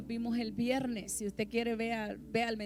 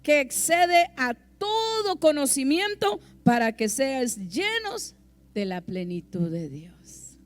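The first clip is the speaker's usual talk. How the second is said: fiery preaching; 8 dB louder than usual. Acted. Preacher proclaiming the fullness of God's love loudly. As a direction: preach the verse with full force.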